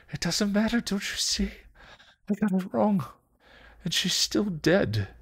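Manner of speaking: very high, grief-stricken pleading; despairing Spike. Acted.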